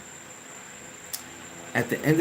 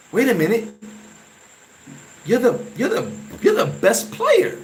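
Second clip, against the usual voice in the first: high and stretched, imitating teammates' surprise; team's amazed realization; 9 semitones higher than usual. Team realizes he's the star.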